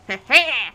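short amused laugh; a quick real chuckle; chuckling. Real, not acted. Laughs at opponent setup.

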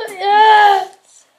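loud, high cheer of victory; triumphant shout. Real, not acted. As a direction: cheer like you just won. Gets the birthday right.

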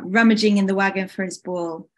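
plain reading voice

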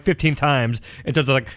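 usual speaking voice

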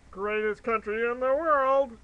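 loud, slow, mock-proud; sarcastic patriotic boast. Real, not acted. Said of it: "I say that facetiously".

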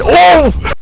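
high laughing shriek; shocked laughing shout. Real, not acted. Shocked at game event.

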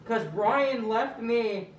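high, loud, drawn-out wail; hammed-up tearful whine; crying complaint. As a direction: bawl it slowly like the abandoned one. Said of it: "Don't have to cry about it".

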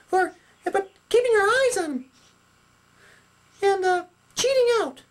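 very high-pitched puppet teacher; Sally puppet, instructing. Acted. Sally explains playing to the audience, instructing puppet voice. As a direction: explain it all in a high squeak.

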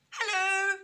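high, loud singsong hello; cheery storybook rabbit; innocent young rabbit. A narrator's reading. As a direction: call it out high and friendly, like a naive bunny.

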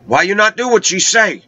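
high, scolding imitation of the healer woman; scolding island woman. Healer woman scolding him for refusing her help, high voice.

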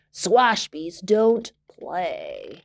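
very slow, gruff reply; old sailor refusing to play; grumpy refusal. Acted. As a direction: growl it out slowly. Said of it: "he answered".

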